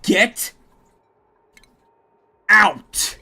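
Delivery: loud barked order; angry shout. Real, not acted. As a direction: throw them out with your voice. Yelling at game NPCs.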